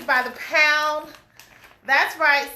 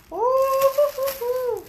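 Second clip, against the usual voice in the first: excited whoop; 10 semitones higher than usual. Real, not acted. Reacting to package, whooping.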